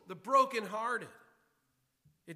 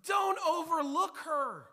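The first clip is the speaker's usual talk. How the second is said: voicing the text's plea, higher and louder; pleading text voiced; 7 semitones higher, 6 dB louder, slower than usual. Pastor voicing the text's plea to notice the woman, higher and louder. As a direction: let the passage itself cry out. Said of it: "It is saying".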